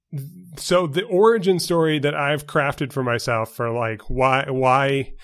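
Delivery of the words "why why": normal talking voice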